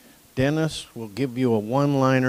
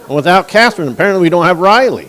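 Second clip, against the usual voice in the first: loud, fast, laughing through the joke; real laughter in a louder voice; 7 semitones higher, 13 dB louder, much faster than usual. Real, not acted. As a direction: chuckle out the punchline, picking up speed. Joking to the crowd about Riley.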